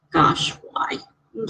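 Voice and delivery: a soft, breathless whispered why; sad whisper. Real, not acted. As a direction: let it slip out as a hushed whisper. Reacting to a pet's death.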